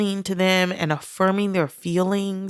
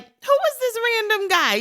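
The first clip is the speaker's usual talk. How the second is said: mock incredulous, pitched way up; suspicious disbelief. Mocking how most people react to a stranger, incredulous. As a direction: play the wary skeptic hearing about a stranger. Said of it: "most people would be like".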